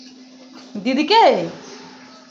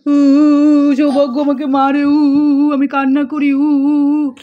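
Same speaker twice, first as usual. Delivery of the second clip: a lightly voiced phone whimpering in pretend; make-believe crying phone, louder oo-oo sobs; mock crying phone; 4 dB louder than usual. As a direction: play the hurt phone's fake whimpers for the child. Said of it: "mobile তো কান্না করবে".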